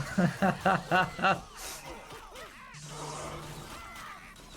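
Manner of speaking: long, high laugh trailing into wheezy silence. Real, not acted. Laughing at a viewer's message.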